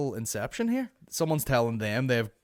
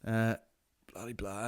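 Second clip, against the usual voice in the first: ordinary delivery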